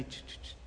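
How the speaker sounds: soft unvoiced ch ch ch noises; breathy mock sound of a sneaky hand-off; imitated ch sounds. Preacher imitating a taquero slipping a taco, soft ch ch ch.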